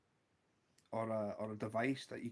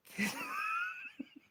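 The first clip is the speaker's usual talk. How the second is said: high, real wheezy giggle; helpless laughter. Real, not acted. Storyteller reaching the book punchline, helpless laughter.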